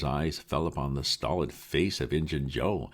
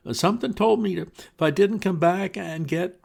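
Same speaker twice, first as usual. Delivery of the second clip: frightened Potter; 7 dB louder than usual. Acted. Terrified Potter confesses.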